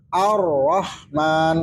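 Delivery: AR-RAHMAN slow, ar-rah-man; doubled R. Shown as a demonstration. Teacher demonstrating two Rs, slow and split. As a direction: separate syllables in "Ar-Rahman", R held. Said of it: "rohnya dua".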